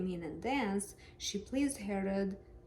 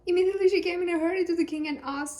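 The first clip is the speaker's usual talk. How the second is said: fast, louder, higher reading with passion; hurried reading; 9 semitones higher, 8 dB louder, much faster than usual. Shown as a demonstration. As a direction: rush it like she's running to the king. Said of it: "gotta put some passion into it".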